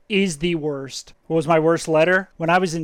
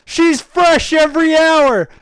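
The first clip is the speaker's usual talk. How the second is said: put-on high, loud, slow kid's shout; screamed out; 9 semitones higher, 6 dB louder, slower than usual. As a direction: blurt it out at the top of your lungs. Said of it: "I just screamed out".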